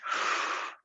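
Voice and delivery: soft unvoiced breath out; breathy exhale. Shown as a demonstration. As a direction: show the long out-breath. Demonstrating breath out.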